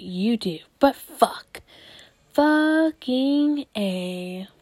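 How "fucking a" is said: slow, stretched-out, higher-pitched relish; theatrical long-held swearing; drawn-out exclamation. Pretend. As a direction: draw every syllable out long. Drawn-out exclamation on toxic sex.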